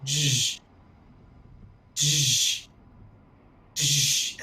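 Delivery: short buzzing hushes, spaced apart; imitated machine noise. Shown as a demonstration. Game master mimicking a trap powering down, imitated noise. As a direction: voice the sound of magic powering down.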